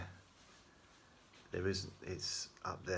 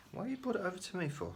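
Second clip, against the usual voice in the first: amused disbelief; 12 semitones higher, faster than usual. Real, not acted. Amused question to pet.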